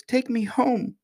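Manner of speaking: put-on high, pleading child voice; acted piteous plea, pitched very high; crying out. A narrator's reading. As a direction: beg like a scared little princess. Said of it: "cried the princess".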